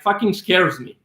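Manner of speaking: ordinary talk, nothing marked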